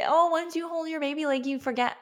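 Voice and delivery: high, sing-song mock reassurance; put-on high voice, quoting cheery advice; mimicking others' reassurance. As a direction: parrot well-meaning advice in a high voice. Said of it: "people had told me, like".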